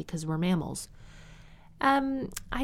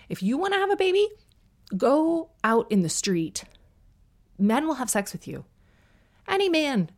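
high squeal, then slowing down; sarcastic advice pitched way up; mocking squeal of a bad idea; mock outrageous suggestion; 8 semitones higher, 3 dB louder than usual. Sarcastic over-the-top advice.

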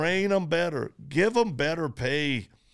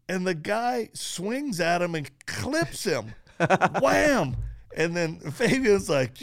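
ordinary talk

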